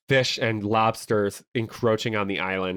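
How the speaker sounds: ordinary talk, nothing marked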